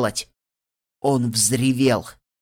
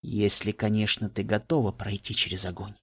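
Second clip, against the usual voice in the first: low, softer, ominous «ты готова пройти через огонь»; dark and ominous; 4 semitones lower, 6 dB quieter than usual. A narrator's reading. The same warning echoing darkly in her head, low and hushed. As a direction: let the words sink like a shadow. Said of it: "Тёмная тень захлестнула меня".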